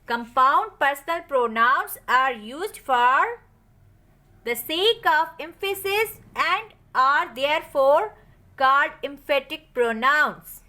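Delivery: very slow, word by word, for students to write down; teacher's slow dictation of a rule. Shown as a demonstration. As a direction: spell it out slowly as if for a notebook. Dictates the definition again.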